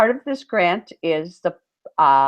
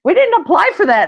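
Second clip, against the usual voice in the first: much higher, disbelieving what; stunned what; 13 semitones higher, 5 dB louder than usual. Retold or imitated. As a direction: squeal it in disbelief. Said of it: "We're like".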